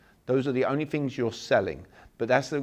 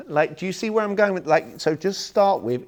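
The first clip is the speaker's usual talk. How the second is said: laughing excitedly; 6 semitones higher, 6 dB louder than usual. Real, not acted. Laughs while pressing point.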